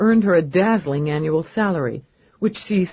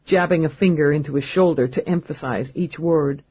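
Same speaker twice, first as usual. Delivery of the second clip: ordinary delivery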